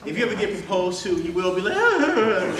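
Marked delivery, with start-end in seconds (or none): 1.8-2.6 high, cracking ahs, half-hearted mock; mock cracking voice of a nervous proposer. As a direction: imitate a voice breaking from nerves. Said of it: "your voice is gonna crack".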